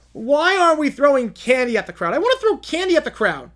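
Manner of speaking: put-on high, loud petulant girl's demand; whiny demanding princess. Sulky princess demanding candy from the parade float.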